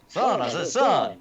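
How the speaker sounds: Foghorn Leghorn impression. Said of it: "like Foghorn Leghorn".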